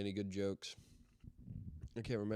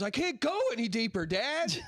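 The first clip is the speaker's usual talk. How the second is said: put-on high, louder strained kid voice; son's punchline; 12 semitones higher, 4 dB louder than usual. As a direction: deliver the punchline high and pushed. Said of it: "the son turns around and goes".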